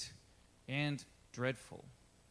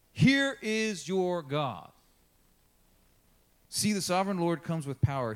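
scripture reading; 4 semitones higher, 7 dB louder than usual. Acted. Reads scripture aloud.

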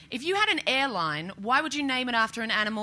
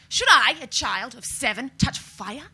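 mock formal accusation; 4 semitones higher, 4 dB louder, slower than usual. Child interrogating mother.

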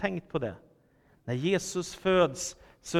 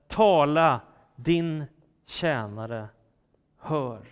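solemn answer; 3 dB louder, slower than usual. Acted. Samuel answers God in the night, solemn and spaced out.

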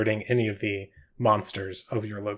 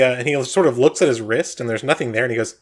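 ordinary talk

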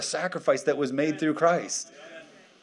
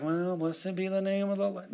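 soft, higher-pitched pious voice; mock meekness; 5 semitones higher, 6 dB quieter than usual. Preacher mimics believers when enemy comes in, mock meek piety. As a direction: put on a feebly pious believer.

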